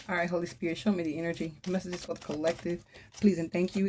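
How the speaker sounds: hushed request for guidance; quiet invocation. Real, not acted. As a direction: ask the spirit softly, almost to yourself. Murmured prayer to Holy Spirit.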